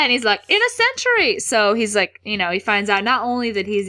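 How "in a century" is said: high-pitched eager correction; mock excitement. Voicing Harry's excited correction.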